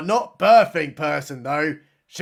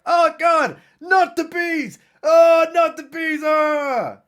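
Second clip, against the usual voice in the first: high, louder shouted panic ending in a scream; mock screaming; 10 semitones higher, 6 dB louder, slower than usual. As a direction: scream it like a movie victim being swarmed. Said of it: "He's like".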